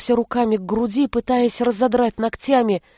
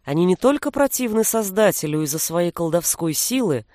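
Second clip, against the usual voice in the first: plain reading voice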